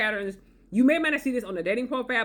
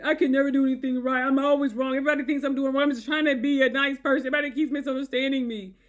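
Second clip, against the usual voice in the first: higher, whiny self-pity; feigned victimhood; 5 semitones higher, faster than usual. Retold or imitated. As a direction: whine like a wronged victim. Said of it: "feigning victimhood".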